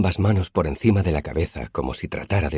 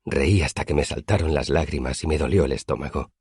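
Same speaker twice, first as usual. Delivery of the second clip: usual speaking voice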